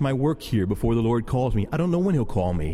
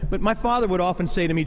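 normal talking voice

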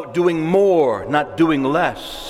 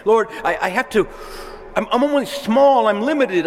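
fearful pleading; 6 semitones higher, faster than usual. Acted. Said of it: "That's the flesh talking".

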